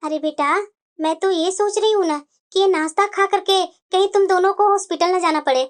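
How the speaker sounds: a sly mother teasing her son; mother-in-law. Acted.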